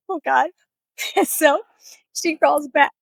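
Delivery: high, loud, talking through laughter; real laughter. Real, not acted. Comedian tries to resume his joke, laughing. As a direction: push on while cracking up.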